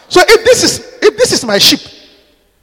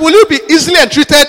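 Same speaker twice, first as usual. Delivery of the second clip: 1 raised insistent question; 4 semitones higher, 8 dB louder than usual. Shown as a demonstration. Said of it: "I said".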